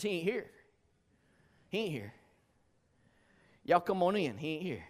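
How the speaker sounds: folksy Southern preacher saying "He ain't here". Shown as a demonstration. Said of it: "the way we'd say it here in the South is".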